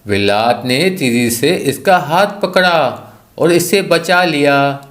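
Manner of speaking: ordinary delivery, nothing marked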